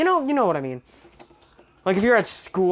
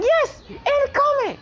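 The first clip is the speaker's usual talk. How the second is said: very high-pitched, breaking big news; excited squeal; slower than usual. Acted. Leone tells the freaking-out group her news, excited squeal.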